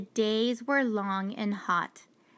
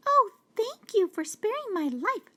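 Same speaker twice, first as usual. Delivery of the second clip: high, tiny mouse voice full of thanks; grateful mouse; 12 semitones higher than usual. Acted. Mouse thanking the lion for sparing her, high and tiny. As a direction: squeak your thanks like a small mouse. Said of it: "said the mouse".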